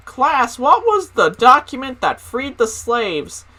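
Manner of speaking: high, slow mock-teacher question; imitated singsong substitute teacher; teacher quizzing. Substitute quizzing class, voiced.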